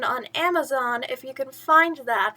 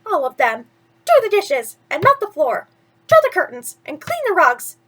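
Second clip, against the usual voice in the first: higher, louder string of spoiled orders; bossy stepsister; 4 semitones higher, 7 dB louder than usual. Acted. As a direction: rattle off chores at the servant. Anastasia barks chore orders.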